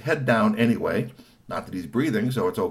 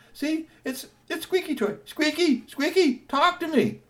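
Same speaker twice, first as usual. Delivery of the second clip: put-on very high, urgent child voice; a kid pleading for his robot; high excited kid; 14 semitones higher, 4 dB louder than usual. Three T insisting it's his robot, very high urgent voice.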